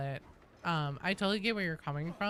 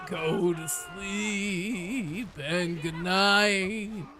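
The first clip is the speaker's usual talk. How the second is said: slow lullaby voice; 4 dB louder, much slower than usual. Pretend. Voicing lullaby to child.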